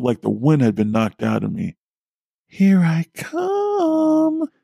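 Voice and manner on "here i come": put-on very high, drawn-out singsong child's taunt; taunting little girl. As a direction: sing it out slowly like a creepy little girl. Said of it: "a little girl's taunting voice".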